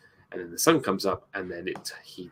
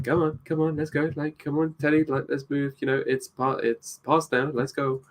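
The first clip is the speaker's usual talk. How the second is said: higher-pitched, insistent coaxing; urging; 6 semitones higher than usual. Retold or imitated. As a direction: imitate someone prodding a statue to move. Coaxing inanimate exhibits to move.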